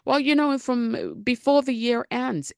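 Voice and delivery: tossing off the same lazy promise again and again; hammed-up daydreamer putting it off; mock someday promise. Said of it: "I meet so many women that's like".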